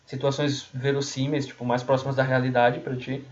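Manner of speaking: ordinary talk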